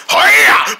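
loud karate yell; fight cry. Acted. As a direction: yell it as you lunge to strike. Attacks in a fight.

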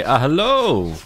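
high, put-on enemy voice; enemy greeting. Imitating the enemy saying hello, high put-on voice. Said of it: "I'm waiting for them to say".

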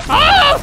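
short, very high, loud scream; startled yelp. Real, not acted. Player going for a horn, startled shriek. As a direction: yelp as if suddenly struck.

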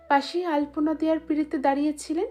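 usual speaking voice

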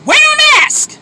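high, loud, exasperated; exasperated yell. Real, not acted. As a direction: yell it in disbelief. Ranting at non-mask wearers.

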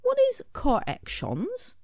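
put-on high, small childlike question; a little character who doesn't understand. A narrator's reading. Scribble asking Smudge what corrections are, very high.

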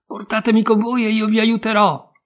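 high and loud, full of pluck; shouting. A narrator's reading. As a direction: call up to the thieves. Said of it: "gridò molto forte Pollicino".

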